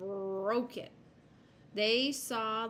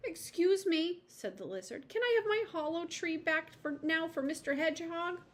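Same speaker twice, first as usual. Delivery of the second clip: high, polite lizard voice; a small creature asking politely; 8 semitones higher than usual. A narrator's reading. Lizard politely asks for tree.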